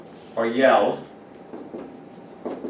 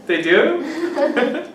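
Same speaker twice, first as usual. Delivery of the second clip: high surprised question; mock surprise at the student; 12 semitones higher than usual. Real, not acted. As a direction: sound amused and caught off guard. Reacting to student's answer.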